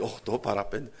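Οκτώ παρά πέντε said low and soft; dismayed low murmur. Retold or imitated. Preacher realizing he is late, dismayed murmur. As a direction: sink into dismay over the late hour.

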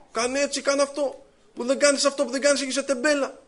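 nagging husband. Retold or imitated. Husband nagging wife, acted.

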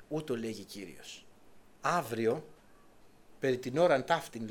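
soft, slow, hushed proclamation; solemn prophecy. Acted. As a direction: intone it gravely and quietly. Prophet proclaims God's word.

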